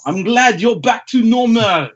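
put-on high, slow French accent; hammed-up French-accented sidekick. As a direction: draw it out in a comic French accent. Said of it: "in a French accent that I can't do".

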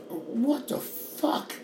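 a slow, high, breathy what the fuck; almost a whisper of disbelief. Real, not acted. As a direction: stretch it out in stunned disbelief. Exasperated rant.

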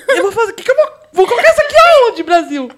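high, loud laughing protest; laughing disbelief. Retold or imitated. Girl staring at a huge pad, laughing in disbelief. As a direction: squeal it through laughter. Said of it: "eu falei".

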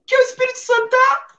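high, probing mom voice; suspicious mother. Retold or imitated. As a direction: play mom sniffing out the truth. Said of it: "a mamãe virou pra mim e falou assim".

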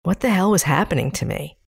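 ordinary delivery

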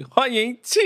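put-on playful Taiwanese Mandarin accent. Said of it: "我们有讲台湾国语的气".

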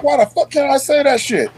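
high-pitched, incredulous pushback; retold indignant challenge. Sergeant retelling his comeback to being corrected, indignant. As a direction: re-enact arguing back, pitch jumping up. Retold argument over cussing.

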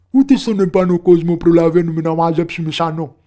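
loud, high goblin complaint; agitated complaining. A narrator's reading. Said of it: "Ρωτούσαν ανάστατοι και διαμαρτύρονταν". (ανάστατη, δυνατή διαμαρτυρία)

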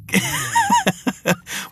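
squealing laugh; can't hold the laugh in; high burst of laughter. Real, not acted. Cracking up while telling viewers the story.

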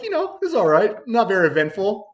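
high, playful innocent reply; joking innocence. Retold or imitated. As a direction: play it off as a joke. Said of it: "just trying to crack a joke with him".

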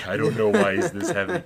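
loud, strained impression of an overburdened guard; mock exasperated outburst; straining complaint. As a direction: blurt it loudly as if hauling dead weight.

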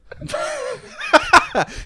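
high shrieking laugh; cracking up hard; loud burst of laughter. Real, not acted.

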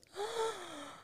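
high, sharp shocked gasp, hands on cheeks; imitated child gasp. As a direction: gasp like a kid hearing bad news. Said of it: "he puts his hands on his cheeks and he goes".

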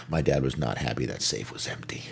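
breathy whispered aside. Real, not acted. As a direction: drop to a whisper.

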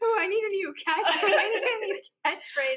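high words through laughter; laughing. Real, not acted. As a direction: still giggling while talking. Still laughing at herself.

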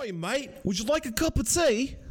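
high, hammed-up cockney British accent; a loud, over-the-top British impression, high; bad British accent. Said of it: "my best British accent".

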